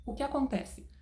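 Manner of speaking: ordinary talk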